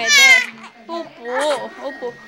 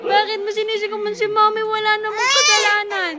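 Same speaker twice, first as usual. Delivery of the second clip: high, mock-aggrieved baby talk; playful scolding; 6 semitones higher than usual. Parent tells baby Mami is blameless, playful scolding. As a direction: plead playfully, pitched up high.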